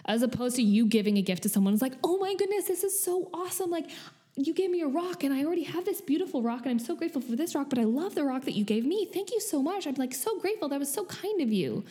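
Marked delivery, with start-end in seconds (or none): 2.0-11.8 much higher, gushing, overjoyed thank-you; squealing with exaggerated gratitude; gushing delight. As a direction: play a receiver thrilled over a mere rock. Overjoyed grateful gift receiver quoted.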